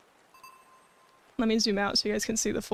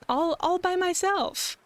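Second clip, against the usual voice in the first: cute anime streamer; 8 semitones higher than usual. Real, not acted. Proud of own model.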